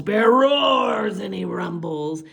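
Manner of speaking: usual speaking voice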